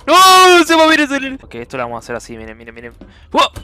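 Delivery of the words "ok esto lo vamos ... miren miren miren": low, soft sneaky voice; quiet scheming. Real, not acted. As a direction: murmur the plan quietly.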